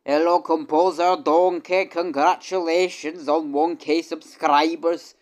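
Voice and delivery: put-on loud, high-pitched Scottish accent. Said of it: "somewhat convincing Scottish accent there".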